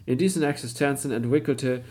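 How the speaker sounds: German read in a put-on English accent; a lightly faked English accent on German; pseudo-English accent. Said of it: "redest mit pseudo-englischem Akzent".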